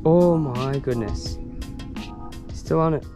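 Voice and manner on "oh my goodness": normal talking voice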